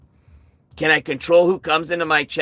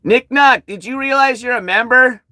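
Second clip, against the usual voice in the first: loud, higher call-out; 9 semitones higher, 6 dB louder than usual. Real, not acted. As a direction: call him out loudly. Calling out a chat member.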